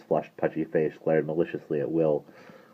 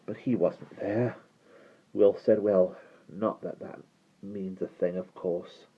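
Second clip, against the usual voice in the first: quiet, weak; 2 semitones higher, 6 dB quieter than usual. A narrator's reading. Weak Will correcting.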